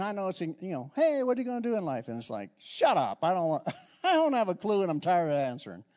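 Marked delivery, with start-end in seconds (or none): 1.0-2.1 high, quick imitation of a nosy asker; pestering questioner. Grown-ups quizzing a youngster about life, mock pestering. Imitating people's constant asking. 2.6-5.8 mock exasperated retort with a laugh; sarcastic answer. Youth answering everyone's nagging question, jokingly sarcastic. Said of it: "That was my sarcastic answer".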